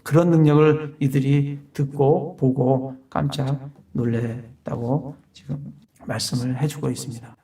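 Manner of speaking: ordinary talk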